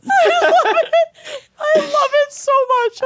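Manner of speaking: high, laughing hard. Real, not acted. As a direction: squeal it through laughter.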